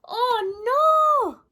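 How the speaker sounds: high, loud cry of dismay; dismayed giant. Acted. George finds the shop closed and cries out in dismay.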